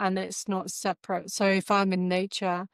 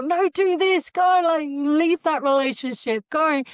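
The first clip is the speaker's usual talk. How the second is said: screaming at the screen; 9 semitones higher, 5 dB louder than usual. Pretend. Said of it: "what would they be screaming out at you".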